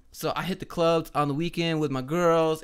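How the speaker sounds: exaggerated Valley Girl party talk; mock a club-going Valley Girl; Valley Girl accent. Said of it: "The Valley Girl accent".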